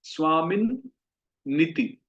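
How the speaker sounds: "स्वामिन निति" with a doubled n, swaamin-niti; correct pronunciation. Shown as a demonstration. Teacher shows the two n sounds in "स्वामिन निति". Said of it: "इसको हमें बोलना चाहिए".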